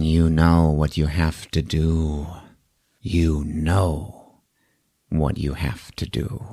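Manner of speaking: low, slow, hushed delivery; quiet, low-pitched portent; ominous retold line. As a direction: intone it low and soft, drawing it out. Ominous line voiced in retelling.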